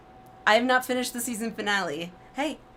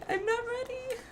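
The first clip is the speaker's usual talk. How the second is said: voice cracking in dread; 12 semitones higher, 8 dB quieter than usual. Real, not acted. Dreading a character's death.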